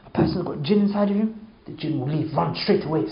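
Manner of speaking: ordinary delivery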